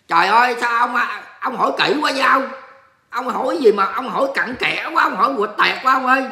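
loud, high-pitched playful protest; mock exasperation. Mock exasperation at nosy viewer question.

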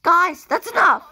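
high pretend scolding; exasperated telling-off. Doll stops friends arguing, high exasperated voice. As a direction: cut in high to stop the bickering. Acted telling-off during argument.

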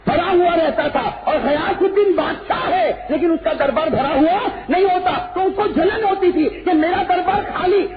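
high, loud sermon at full force; impassioned sermon voice; fiery preaching. Acted. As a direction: preach it out to the crowd, building the pitch.

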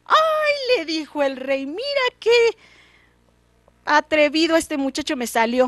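high, shocked king voice; astonished king. Acted. The king reacts to the boy's bold demand, shocked and high. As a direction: gasp at such nerve.

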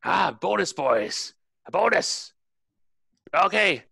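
high, punchy barked orders; commanding shout with gaps between orders; captain bellowing. Acted. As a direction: bellow commands up at the rigging, word by word. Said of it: "the captain bellows you down".

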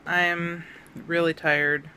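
normal talking voice

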